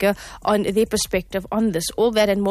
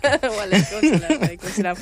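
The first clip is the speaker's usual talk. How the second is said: laughing; faster than usual. Real, not acted. Host laughing at breathless cohost.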